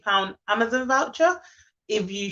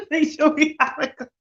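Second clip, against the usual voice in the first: talking through a laugh; real laughter while speaking; 5 semitones higher than usual. Real, not acted. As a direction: giggle through the words. Laughs while picking again.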